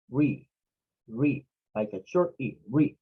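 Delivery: RE with a short, clipped E. Shown as a demonstration. Teacher modelling the first syllable, short E in RE. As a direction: say RE with a short E sound.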